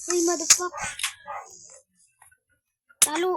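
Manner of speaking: loud frustrated yell; angry shout. Real, not acted. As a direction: curse at the top of your voice. Loses fight, yells.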